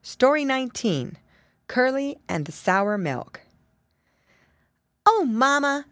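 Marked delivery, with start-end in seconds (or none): 5.1-5.8 put-on higher-pitched excited little piggy boy; excited exclamation. A narrator's reading. Piggy boy rushes in to mama, excited exclamation. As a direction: burst out to get mama's attention. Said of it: "exclaimed Curly".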